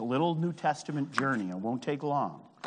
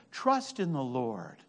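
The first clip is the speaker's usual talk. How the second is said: ordinary talk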